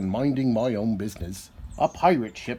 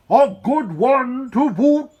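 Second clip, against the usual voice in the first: much higher, louder, slow crew voice; pirate crew chorus; 10 semitones higher, 8 dB louder than usual. A narrator's reading. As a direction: echo the captain as a rowdy crew. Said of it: "the others agreed".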